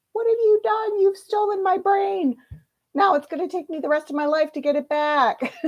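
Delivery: high, indignant mock youth; youth confronting. A reader voicing future youth, outraged and high. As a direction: shriek it as an outraged kid. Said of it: "youth ... go".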